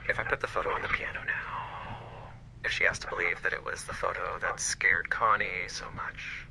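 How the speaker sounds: hushed whisper to herself; whispered thought. Acted. Maris whispers her plan to herself. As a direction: whisper the secret plan under your breath.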